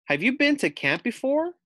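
high curious little voice; pug Pearl. Acted. Pug asking the new camper. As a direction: ask it like an eager young pug. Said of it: "Pearl asks".

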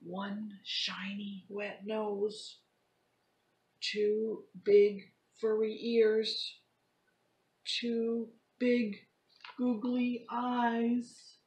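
lively storybook read-aloud; reading to children. Acted. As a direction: act the story out for kids. Teacher reads picture book to class.

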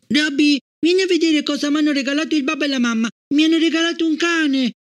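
put-on high, fast excited child's call. A narrator's reading. Gloria calling Robby from the stairs, excited child's shout. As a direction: shout the news down the cellar stairs.

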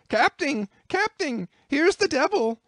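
high, loud panicked yokel yell. A narrator's reading. Bumpkin running to the captain, high panicked yell. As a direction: shriek it like a terrified country boy. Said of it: "yelled the bumpkin".

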